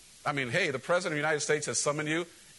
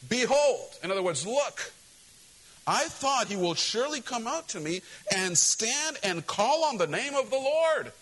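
indignant proclamation; 6 semitones higher, slower than usual. Acted. Naaman complaining, read aloud.

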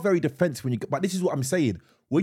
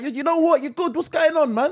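high, animated street greeting; slipping into road talk; 12 semitones higher, 3 dB louder than usual. Shown as a demonstration. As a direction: demonstrate an easy road-man hello. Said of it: "you slip into it".

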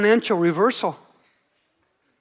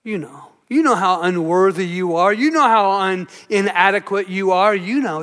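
lightly voiced low, insinuating accuser; understated Satan, pitched down; accusing Satan; 4 semitones lower than usual. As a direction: needle them with low, knowing accusations, pausing between each. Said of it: "But Satan says".

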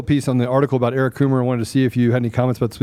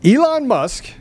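dramatic announcement; 12 semitones higher, much slower than usual. Acted.

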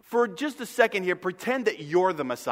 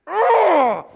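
loud frustrated groan; 12 semitones higher, 10 dB louder than usual. Retold or imitated. Exasperated Jesus.

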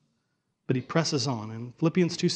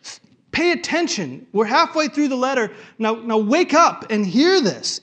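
much higher and louder, pressing; Paul demanding the readers listen; urgent insistence; 8 semitones higher, 7 dB louder than usual. Retold or imitated. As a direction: build it up and demand attention. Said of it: "He's saying".

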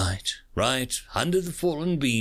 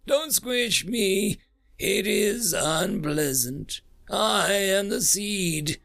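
slow, higher, feeble creature plea; feeble mutter; 8 semitones higher, slower than usual. Acted. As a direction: mutter it weakly like a frightened shadow. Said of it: "it muttered feebly".

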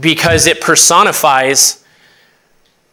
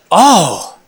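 drawn-out "oh" swooping up and down; mock realisation; 3 semitones higher than usual. Preacher voicing coworkers who learn it was a joke, mock realisation. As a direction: imitate someone suddenly getting the joke.

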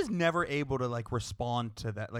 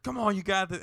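higher mock pep talk; lightly voiced hype-man trainer; trainer cheering; 7 semitones higher, 3 dB louder than usual. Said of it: "then he's like".

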